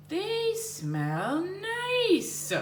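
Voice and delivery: put-on high sweet girl voice, drawn out slowly; a sing-song little girl voice. Shown as a demonstration. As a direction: lift it way up like a delighted child. Voicing Jenny sweetly.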